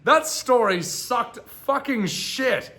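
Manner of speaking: high, slow, drawn-out condemnation; emphatic disgusted verdict. Real, not acted. Streamer passing verdict on the tale, emphatic disgust. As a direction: stretch each word in incredulous complaint.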